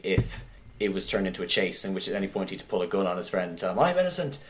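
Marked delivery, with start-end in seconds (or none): 3.8-4.4 high-pitched mock plea of innocence; desperate protest. Accused man protesting to his friend at gunpoint, mock-desperate. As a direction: jump up high as if pleading.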